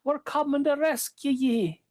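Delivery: fairy king calling. Acted. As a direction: call out from far off. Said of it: "cries out Brian Connors".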